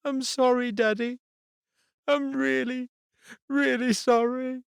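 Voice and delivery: put-on a hurt girl accusing the boy; tearful little girl. A narrator's reading. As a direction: daughter telling her dad through tears. Crying daughter apologises.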